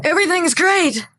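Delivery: high, overbright fake cheer; fake cheerful. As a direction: chirp it like nothing's wrong. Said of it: "I'm acting".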